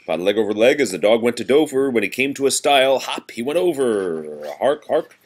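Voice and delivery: lively sing-song nursery rhyme reading; reading to children. Acted. Parent reading seasons rhyme to a toddler, sing-song. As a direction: read it bouncy for a small child.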